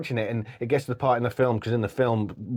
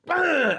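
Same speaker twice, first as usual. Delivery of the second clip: imitated crash. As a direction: blurt out the impact sound. Crash noise in the film.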